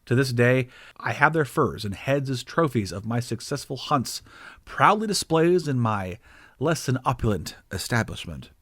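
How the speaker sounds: ordinary delivery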